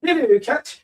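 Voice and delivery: high calling greeting; shouting at the cat. Real, not acted. Streamer greeting his cat mid-match with a high call. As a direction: sing out to the cat from afar. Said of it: "why am I shouting at the cat?".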